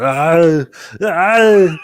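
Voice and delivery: loud, rising strained groan; exaggerated strongman groan; Arnold Schwarzenegger groan. Shown as a demonstration. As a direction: groan it out like an action hero. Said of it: "the groan that Arnold does".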